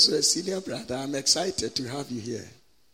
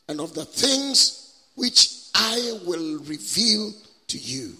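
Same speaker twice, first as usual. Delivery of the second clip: scripture proclamation; 5 semitones higher, 4 dB louder than usual. Acted. Preacher reads scripture to the congregation, solemn proclamation. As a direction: declaim it phrase by phrase, pausing between.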